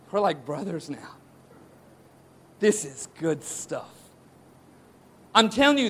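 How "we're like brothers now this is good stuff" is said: much lower and softer, slow; hushed friendly aside. Retold or imitated. Jonathan giving David his armor, hushed brotherly murmur. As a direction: murmur it like a close confidant. Essentially implying.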